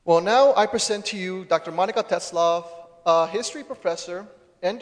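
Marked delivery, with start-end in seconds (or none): none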